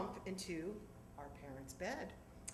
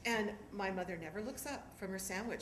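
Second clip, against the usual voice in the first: ordinary talk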